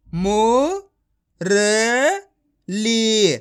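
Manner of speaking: मु, र, ली with long gaps between; each syllable of मु, र, ली said alone. Shown as a demonstration. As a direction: sound out मु... र... ली. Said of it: "direct मात्रा का उच्चारण करके".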